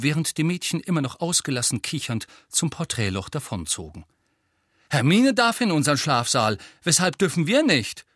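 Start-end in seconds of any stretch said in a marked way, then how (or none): 4.9-8.1 loud, higher-pitched outrage; indignant protest. A narrator's reading. Ron protesting to Harry about the dormitory rule, indignant. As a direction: protest the injustice loudly.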